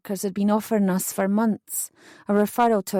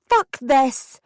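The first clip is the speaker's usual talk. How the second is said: outraged outburst; 4 semitones higher, 4 dB louder than usual. Acted. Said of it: "I stormed off".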